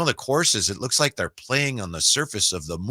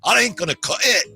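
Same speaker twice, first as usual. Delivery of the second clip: a higher-pitched mock Scottish accent; playful Scottish brogue; 9 semitones higher than usual. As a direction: scold Tiger like an old Scottish caddie. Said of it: "That's a little Scottish".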